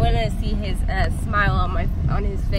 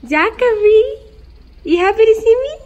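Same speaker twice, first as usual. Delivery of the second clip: much higher, louder playful greeting; 11 semitones higher, 6 dB louder than usual. Real, not acted. Mom greets son at daycare.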